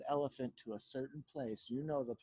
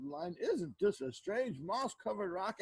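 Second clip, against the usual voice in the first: slow, higher, drawn-out lion voice; marveling lion; 5 semitones higher, slower than usual. A narrator's reading. Lion answers Anansi at the rock, marveling. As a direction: stretch it out in wonder. Lion says the magic words.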